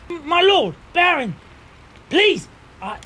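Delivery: high, loud, urgent plea; pleading knight. Acted. Knight pleads with his lord.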